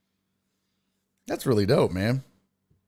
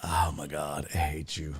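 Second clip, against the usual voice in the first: much lower and softer, under his breath; muttered mock hate; 11 semitones lower, 9 dB quieter than usual. Real, not acted. Streamer to chat after a joke, muttering. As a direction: grumble it to himself.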